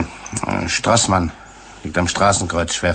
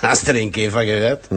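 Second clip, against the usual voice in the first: ordinary talk, nothing marked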